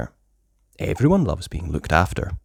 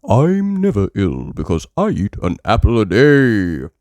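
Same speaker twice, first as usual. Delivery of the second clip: Doctor Brown Bear; 7 dB louder than usual. Acted. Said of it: "says Doctor Brown Bear".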